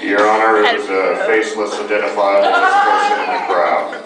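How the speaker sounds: low, solemn mock testimony; mock courtroom witness.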